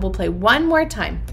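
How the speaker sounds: ordinary talk, nothing marked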